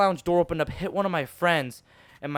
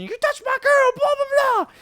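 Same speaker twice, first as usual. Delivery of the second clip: very high, loud squeaky accusation; mock yelling; 5 dB louder than usual. Little boy confronting the bully over his girl, mock shrill yelling. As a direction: shriek it like a furious little kid. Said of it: "I was yelling at him".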